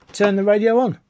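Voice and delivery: ordinary talk, nothing marked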